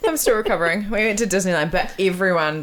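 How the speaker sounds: ordinary delivery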